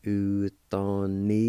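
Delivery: slow, careful Utanila; slow demo. Shown as a demonstration. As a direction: say Utanila slowly, each syllable clear. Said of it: "It's pronounced like this".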